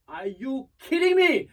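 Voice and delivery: high, loud, drawn-out mock scream; screamed disbelief. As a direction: imitate an outraged runner-up screaming, slowly. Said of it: "She screamed".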